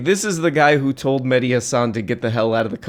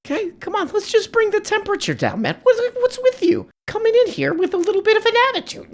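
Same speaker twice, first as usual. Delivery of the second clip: very high, squeaky mock appeal; mock falsetto pleading; faster than usual. Host mimics pleading in falsetto.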